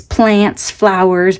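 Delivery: ordinary talk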